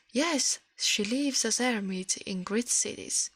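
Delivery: ordinary delivery, nothing marked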